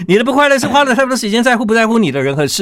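一口气飞快念完“你的不快乐是花了太多时间在乎不在乎你的人和事”; all in one breath. Shown as a demonstration. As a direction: rush the whole title out without a pause, pitched high. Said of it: "作家本人亲自示范什么叫失败". (一口气念完书名)

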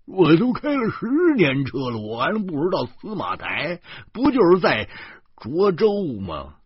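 loud, high-pitched bragging; chest-thumping boast. A narrator's reading. Driver boasts of ten years at the wheel, loud and cocky. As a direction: brag at full volume, drawing out the last word. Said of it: "周胖子拍着胸脯".

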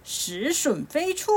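high, slow, drawn-out command; storybook spell-casting call. A narrator's reading. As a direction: call out a magic order, stretching it. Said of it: "对着袋中喊一声".

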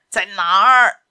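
explosive, very loud shout; furious demand. Acted. Captain demanding where the captive is, shouted. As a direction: burst out shouting the question.

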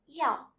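"yao" loud, falling steeply from high; fourth tone. Shown as a demonstration. Teacher introducing Chinese word for medicine, fourth tone on "yao". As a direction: drop the pitch fast through "yao". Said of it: "word with the fourth sound is yao".

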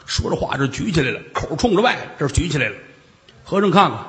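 ordinary delivery, nothing marked

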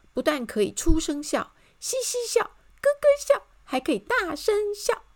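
loud decree climbing to high giggly voice; joyful proclamation. A narrator's reading. Narrator reads the changed sign, gleefully proclaiming. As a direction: announce it with mounting glee. Reading the rewritten notice aloud.

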